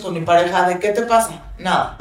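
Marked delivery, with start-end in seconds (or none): 1.6-2.0 low, soft imitation of a closed-off partner; dismissive denial. Partner dodging being asked what's wrong, dismissive. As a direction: voice a quiet brush-off, understated.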